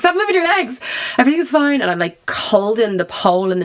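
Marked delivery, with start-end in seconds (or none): 0.0-1.8 high, frantic plea to keep still; panicked order. Retold or imitated. Scared of heights, begging him to keep still, high and frantic. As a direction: pitch it high like you're terrified of falling. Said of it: "I was like".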